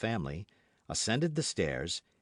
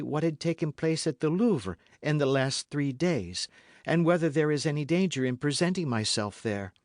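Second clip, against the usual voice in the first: raised pitch for a lady in trouble; lightly voiced Madame Bonacieux, anxious; 7 semitones higher, 4 dB louder than usual. A narrator's reading. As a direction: explain earnestly in a higher woman's voice. Madame Bonacieux explains her plan.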